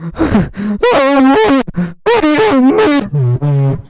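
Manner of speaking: high, loud, very slow scared voice; high loud frightened girl voice; shaky. Acted. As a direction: drag it out high and afraid. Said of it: "Po said shakily".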